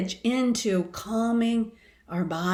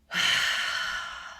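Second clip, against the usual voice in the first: relieved exhale; 4 dB quieter than usual. Shown as a demonstration. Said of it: "then all of a sudden go".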